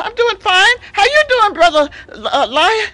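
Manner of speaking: high, loud friendly greeting; friendly giraffe. Acted. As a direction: call up cheerfully as a tall giraffe. Giraffe answers the lion.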